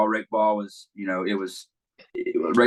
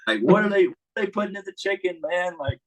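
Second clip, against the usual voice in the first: amazed; 9 semitones higher than usual. Real, not acted. Amazed at grown former players.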